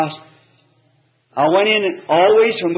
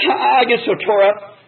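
higher, slow, retold upset reply; upset wife; 6 semitones higher than usual. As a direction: plead slowly as an upset wife. Crying wife's retold reply.

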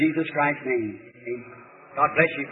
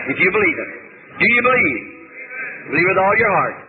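rallying proclamation; 4 semitones higher than usual. Real, not acted. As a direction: proclaim it to the whole congregation. Rallying the congregation in praise.

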